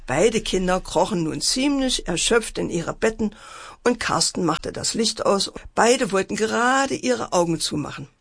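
ordinary delivery

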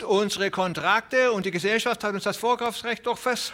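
very high, quavering plea; whining old man. Acted. Old Broderson protesting the broken contracts, whining.

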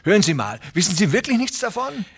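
high, fast, fretful little tenant pleading; a nervous little farmhand begging not to lose his place; Hooven the anxious tenant. Acted. Little tenant farmer pleading with Presley, high and anxious.

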